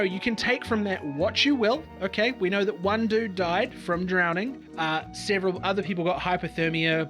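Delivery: usual speaking voice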